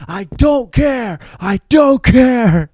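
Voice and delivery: hammed-up drunk despair; mock wailing. Ben Affleck in back of car.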